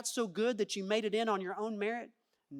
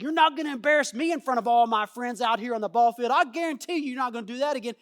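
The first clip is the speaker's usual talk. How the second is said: self-righteous parent; 2 semitones higher, 9 dB louder than usual. Acted. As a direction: snap at your child in front of the other parents. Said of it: "this self-righteous thing that's inside you".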